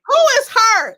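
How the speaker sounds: loud disbelieving echo; incredulous loud. Real, not acted. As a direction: shout the question back. Echoing louder.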